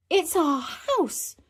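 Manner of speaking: high, stretched-out delight; drawn-out squeal of discovery; amazed child. Acted. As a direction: marvel slowly in a high voice. Peppa sees home from above.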